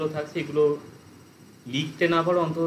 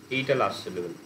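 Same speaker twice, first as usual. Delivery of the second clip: ordinary talk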